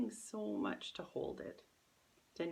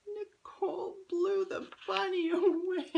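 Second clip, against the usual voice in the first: put-on much higher and louder, a sobbing little girl; crying child; 13 semitones higher, 9 dB louder than usual. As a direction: blurt it out like a weeping child.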